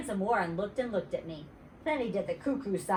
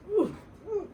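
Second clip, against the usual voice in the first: excited squeal; 10 semitones higher than usual. Real, not acted. Reader interrupted, excited.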